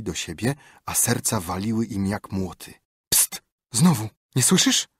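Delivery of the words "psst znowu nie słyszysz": tense whisper. Acted. Frightened boys hearing noises.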